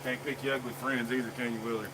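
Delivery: ordinary delivery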